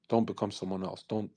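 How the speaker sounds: normal talking voice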